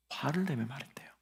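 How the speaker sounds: whispered. Shown as a demonstration. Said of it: "뭐, 어떻게 말했다고요?".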